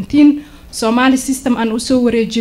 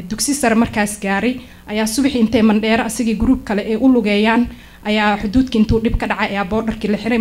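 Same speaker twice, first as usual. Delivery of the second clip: usual speaking voice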